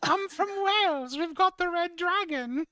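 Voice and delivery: high mocking put-on voice; mock heel promo. Mocking Tegan's heel promo to the co-host, in a high put-on voice. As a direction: play a wannabe tough heel. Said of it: "mocking Tegan's heel promo".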